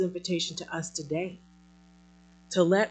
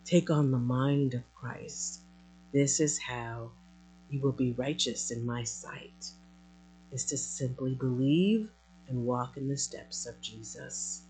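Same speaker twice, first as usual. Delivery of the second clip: softer, lower, tender reassurance; tender voice of God; 4 semitones lower, 7 dB quieter than usual. Acted. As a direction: gently soothe a dear child as God. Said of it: "you just simply are saying to us".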